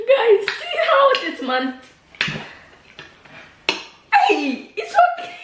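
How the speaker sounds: real laughing aside to viewers; laughing while talking. Real, not acted. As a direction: speak while still laughing, pitch up. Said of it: "You see how I'm shocked?".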